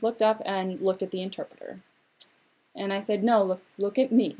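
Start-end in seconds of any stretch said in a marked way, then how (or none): none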